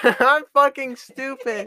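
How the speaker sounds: loud slow laughing self-mockery. Real, not acted. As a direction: laugh at yourself. Laughing at own mistake.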